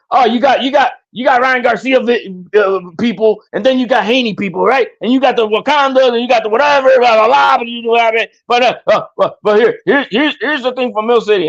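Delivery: high, mocking voice imitating a rival; mocking quote. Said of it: "he was trying to".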